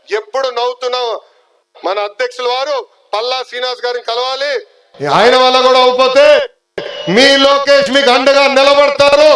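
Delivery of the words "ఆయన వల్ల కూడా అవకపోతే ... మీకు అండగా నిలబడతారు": full-voiced louder rally cry; rally shout. Real, not acted. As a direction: shout the pledge out to the crowd. Rally crowd, pledging support. (సభలో గట్టిగా అరుపు)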